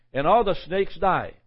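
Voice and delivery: normal talking voice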